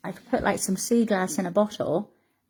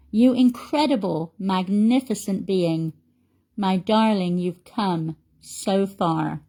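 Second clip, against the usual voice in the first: heartfelt poem read aloud to a friend; poem recitation; 3 semitones higher, 3 dB louder, slower than usual. Real, not acted. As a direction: recite it slowly and emphatically, pausing after each line. Reads a poem to a follower.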